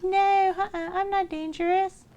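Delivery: put-on high-pitched little girl voice; small, high and innocent. Little girl assuring the wolf family, innocent. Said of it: "Little girl, are you dangerous?".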